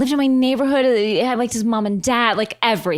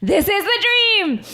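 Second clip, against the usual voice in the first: high, slow ecstatic half-shout; high, slow gushing shout of joy; giddy squeal; 12 semitones higher, slower than usual. Retold or imitated. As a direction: gush like a dream came true. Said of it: "And I was like".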